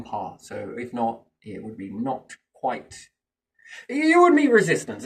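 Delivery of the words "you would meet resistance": emphatic burst. Real, not acted. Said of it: "emphatic point".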